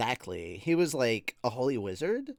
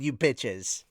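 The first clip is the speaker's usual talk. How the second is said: ordinary talk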